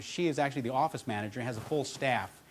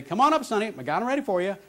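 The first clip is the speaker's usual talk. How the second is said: higher, louder friendly call to a regular; Ruby greeting; 5 semitones higher, 4 dB louder, faster than usual. Retold or imitated. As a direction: call out cheerfully like a drive-thru server. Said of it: "she'd say".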